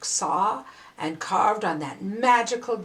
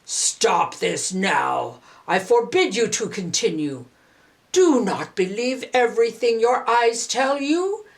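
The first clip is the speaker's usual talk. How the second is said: dragon's commanding roar; 4 dB louder than usual. Acted. Dragon Qiu Yuan orders the villagers to go home, commanding roar. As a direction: command them like a towering dragon. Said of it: "the dragon Qiu Yuan roared out".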